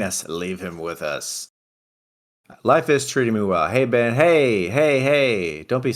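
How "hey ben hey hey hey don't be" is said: high, loud eager hello; excited greeting. Real, not acted. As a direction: call out to him eagerly. Greeting a pet on stream.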